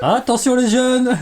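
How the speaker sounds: high, loud, slow warning; warning call. Acted. Warning friends about the catch. (avertissement lent et fort)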